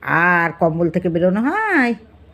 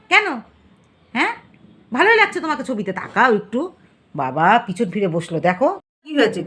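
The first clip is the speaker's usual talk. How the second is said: ordinary talk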